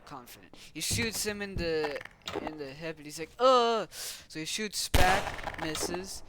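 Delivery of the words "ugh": loud, high pretend grunt; sudden play-acted yelp of pain; mock pain grunt. Figure shot in the hip, mock pain grunt.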